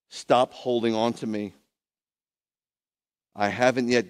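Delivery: loud, spaced declaration; acted command. Yeshua sends Miriam to his brothers, loud firm instruction. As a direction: proclaim it firmly with long pauses.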